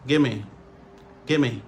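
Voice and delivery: "gimme" with the v dropped; casual one-word "gimme"; reduced form. Shown as a demonstration. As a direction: run it together as "gimme". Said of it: "Réduction".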